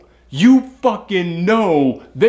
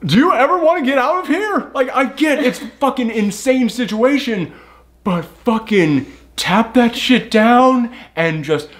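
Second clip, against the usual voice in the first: incredulous; 8 semitones higher than usual. Retold or imitated. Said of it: "I'm just like".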